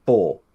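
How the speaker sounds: "full" said as "four" with a softened R; no hard R. Shown as a demonstration. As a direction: let the end of "full" drop into a vowel, British-style. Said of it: "You can't have the hard R".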